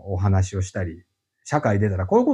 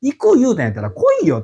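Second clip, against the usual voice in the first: much higher, imitated irritated scolding; mock scolding; 12 semitones higher, 4 dB louder than usual. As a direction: toss it off like a fed-up boss. Recalled irritation at clients. (約束を破る利用者へのいら立ちを真似た、高めの叱り声)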